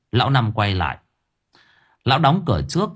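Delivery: plain reading voice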